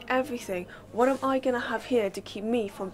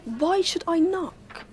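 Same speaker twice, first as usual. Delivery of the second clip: higher, louder and slow, offended; indignant retort; 7 semitones higher, 5 dB louder, slower than usual. Acted. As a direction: throw the question back, affronted. Mother defends barging into room.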